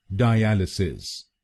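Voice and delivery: डायलिसिस slowly, die-AL-uh-sis, stress on AL; drawn out डायलिसिस with AL stressed; slow correct pronunciation. Shown as a demonstration. Teacher repeats the right form slowly, die-AL-uh-sis. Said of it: "सही pronunciation है".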